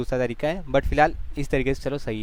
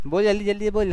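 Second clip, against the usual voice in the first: higher, insistent hurry-up chant; hurrying urging; 6 semitones higher than usual. Real, not acted. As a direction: rush the student to speak. Teacher hurrying student to speak. (जल्दी मचाती ऊँची आवाज़)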